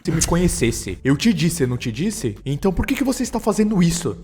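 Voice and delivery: rising frustration, let it burst out by the end; yelling at mom. A narrator's reading. Son snapping at his mother, a building yell. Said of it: "Ele então começa a gritar".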